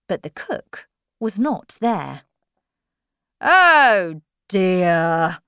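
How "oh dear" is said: loud, long-drawn dismay; storybook king. Acted. King finding the cook gone, loud drawn-out dismay. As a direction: drag out both words loudly. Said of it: "the king said".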